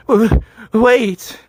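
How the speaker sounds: high, loud stammered plea; whimpering plea. A narrator's reading. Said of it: "I whimpered".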